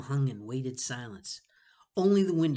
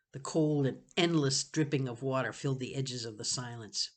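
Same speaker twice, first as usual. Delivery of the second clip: plain reading voice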